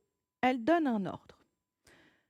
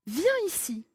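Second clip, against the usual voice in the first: calling out; 6 semitones higher than usual. Acted. Someone calling the child over.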